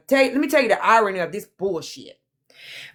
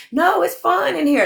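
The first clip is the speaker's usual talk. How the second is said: put-on much higher, whiny girlish reply; imitating young girl; 10 semitones higher than usual. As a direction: play the kid who wants to stay. Voicing the girl's reply.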